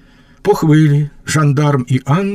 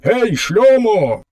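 shouting; 8 semitones higher, slower than usual. Acted. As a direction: bellow across the tavern, slow and high. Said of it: "Кричить".

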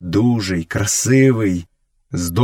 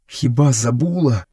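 usual speaking voice

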